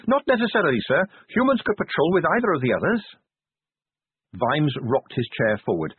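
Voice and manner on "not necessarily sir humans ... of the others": put-on lightly raised, eager and polite young captain; earnest young officer, keen and respectful, slightly quick; higher earnest young officer. A narrator's reading. Captain tactfully proposing patrol changes to his commander, earnest higher voice.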